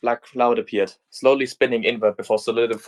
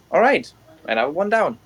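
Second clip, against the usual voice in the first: put-on brisk, pleased young fighter; upbeat heroine voice; 7 semitones higher than usual. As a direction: shrug off the win, chipper. Nero after the fight.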